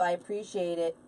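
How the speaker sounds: normal talking voice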